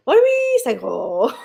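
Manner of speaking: slow, put-on dog baby talk. Host voicing her dog in slow baby talk. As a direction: drag it out like the dog.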